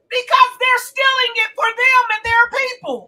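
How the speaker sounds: high, loud indignant answer; heated outburst. Real, not acted. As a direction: raise your voice and drive the point home. Angrily answers own rhetorical question.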